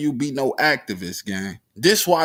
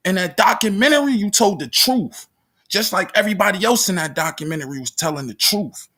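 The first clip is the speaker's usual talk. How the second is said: fast heated rant; 4 semitones higher, faster than usual. Real, not acted.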